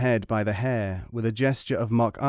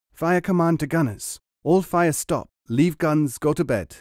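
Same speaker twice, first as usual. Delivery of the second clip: higher, louder broken-English orders; Zelikov's broken version; 6 semitones higher, 4 dB louder than usual. Acted. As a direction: play the grinning soldier barking pidgin commands. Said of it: "was his version of the message".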